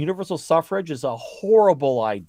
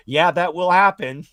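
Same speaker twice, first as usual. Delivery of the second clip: higher, louder sarcastic agreement; sarcastic dispatcher shrugging it off; a dispatcher's mock sarcasm; mock deadpan dispatcher; 6 semitones higher, 8 dB louder than usual.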